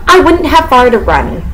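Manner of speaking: usual speaking voice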